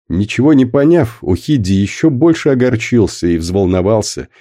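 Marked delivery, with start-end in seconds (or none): none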